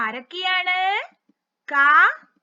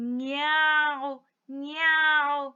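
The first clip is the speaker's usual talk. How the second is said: slow, gentle cat meow; drawn-out meows; 3 semitones lower, much slower than usual. Acted. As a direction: imitate a kitten's meow. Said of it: "എങ്ങനെയാ പൂച്ചക്കുട്ടൻ കരയുന്നത്?".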